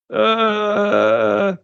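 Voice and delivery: long drawn-out "uh"; mock blank, stretched filler; stumped hesitation. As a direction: imitate someone with nothing to say back. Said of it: "he couldn't say anything back".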